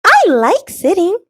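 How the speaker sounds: high, contented little acorn; a small high voice that likes sitting; high and pleased. A narrator's reading. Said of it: "says the acorn".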